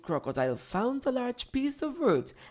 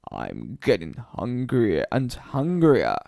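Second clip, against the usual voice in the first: lower, grumbling voice; hungry crocodile; 5 semitones lower, 3 dB louder than usual. A narrator's reading. Crocodile grumbling to himself about his hunger. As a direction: growl it with hunger. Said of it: "he said".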